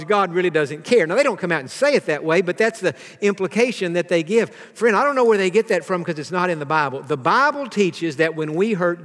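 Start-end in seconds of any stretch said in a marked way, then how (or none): none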